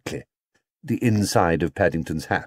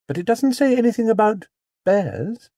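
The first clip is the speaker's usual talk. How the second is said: put-on high, sweet innocent girl; high, guileless question; innocently; 13 semitones higher than usual. A narrator's reading. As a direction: ask with wide-eyed innocence. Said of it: "asked Judy innocently".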